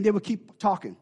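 ordinary talk

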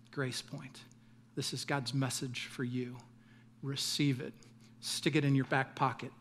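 hushed and slowing benediction; soft closing blessing. Acted. Pastor blesses Grace Point congregation in a hushed voice.

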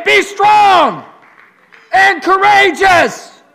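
high, slow, full shout; shouted command. Acted. Preacher shouting God's charge at the room. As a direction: bellow each word.

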